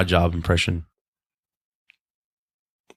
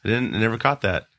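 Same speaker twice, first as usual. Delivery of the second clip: normal talking voice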